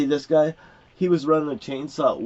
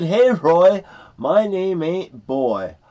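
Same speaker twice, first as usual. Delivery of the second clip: loud, high comeback, drawn out at the end; retold angry retort; 7 semitones higher, 8 dB louder, much slower than usual. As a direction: re-enact a forceful telling-off. Retelling own angry retort.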